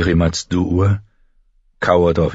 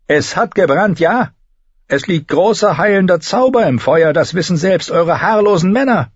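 high, loud character voice; indignant healer woman; 13 semitones higher, 6 dB louder than usual. A narrator's reading. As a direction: defend your remedy forcefully.

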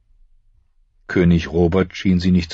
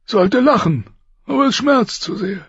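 high, strained voice of a dying king; a wounded king giving his last orders, higher and louder; 4 dB louder than usual. Acted. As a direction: push each word out through pain. Robert speaks.

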